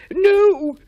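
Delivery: high, loud nervous gulp; frightened gulp. A narrator's reading. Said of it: "gulped Henry".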